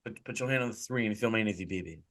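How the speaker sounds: lightly voiced wrestler impression, soft and quick; Dusty. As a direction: slip into a hushed impression, dropping low at the end. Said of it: "I'm like Dusty".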